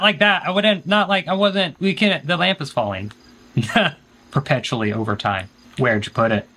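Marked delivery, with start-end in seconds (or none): none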